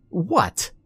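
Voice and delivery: high indignant "What?"; a boy protesting his innocence; indignant shout. A narrator's reading. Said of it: "Danny shouted".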